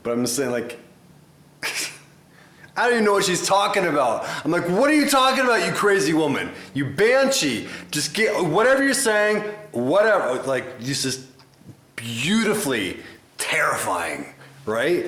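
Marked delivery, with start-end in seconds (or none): 4.7-10.4 much higher, louder incredulous yell; hammed-up yell at a crazy woman; mock shout. Mock yelling at the banshee singer.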